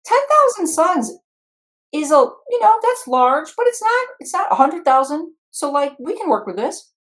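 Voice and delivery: very high, loud comic squeak; mock falsetto pep talk; put-on negotiator voice. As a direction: pitch it way up to reason with the guys. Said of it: "I say".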